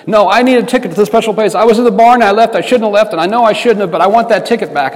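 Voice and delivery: a frantic customer who won't wait; agitated demand. Retold or imitated. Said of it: "you're gonna have to calm down".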